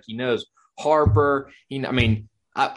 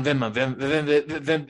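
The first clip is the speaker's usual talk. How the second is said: stuck on "Vem-", stammering it over; a nervous buyer who can't finish the word; mock stammer. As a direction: act out getting tongue-tied on Venmo. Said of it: "I can't even get the words out".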